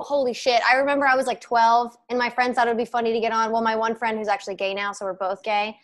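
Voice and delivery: ordinary delivery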